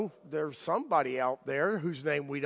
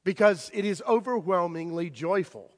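ordinary talk, nothing marked

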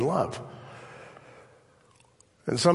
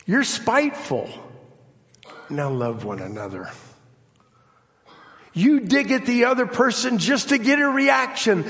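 preaching at full force; 7 semitones higher than usual. Acted. Preacher to congregation, crying out then calming. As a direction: cry out each fault, then calm back to the refrain. Preacher alternating cries and refrains.

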